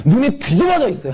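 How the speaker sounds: excited exclaim. Real, not acted. Preacher exclaims at peak.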